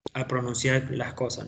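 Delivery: ordinary talk, nothing marked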